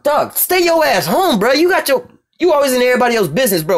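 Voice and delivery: exasperated scolding. Acted. Scolding America, voiced exasperation.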